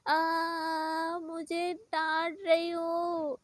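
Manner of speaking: put-on slow, drawn-out childish wail; whiny fake crying. Scolded little girl whining back at Lisa, crying again. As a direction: sob it out like a sulking kid. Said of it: "फिर से रोने लगती है".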